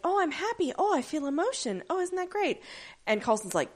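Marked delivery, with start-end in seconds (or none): 0.0-2.6 high, airy mock-cheerful android; giddy Ada. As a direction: play her dazed with new joy. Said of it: "Ada comes in and she's like".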